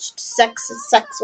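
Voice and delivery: slow breathy whisper, shrugging it off; hushed, offhand and slow; whispered aside. Acted. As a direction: drop to a whisper like a secret. Dismissive aside about the fling.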